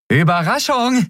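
very high-pitched call; spring it on them, voice leaping up high; ambush cry. A narrator's reading. Endriel jumping out at the guards, high surprise cry.

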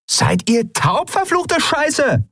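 high, drawn-out angry yell; exasperated shout. A narrator's reading. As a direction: swear at the crew, slowly and very high. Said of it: "rief die Frau wieder". (gedehntes, wütendes Schreien)

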